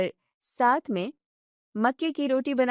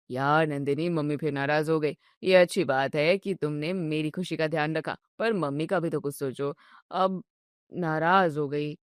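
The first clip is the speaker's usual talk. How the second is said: lower, sulky complaining husband voice; grumpy husband; 7 semitones lower than usual. Acted. Husband grumbling at his wife over dinner, low and sulky. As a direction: whine about dinner like a tired, spoiled son.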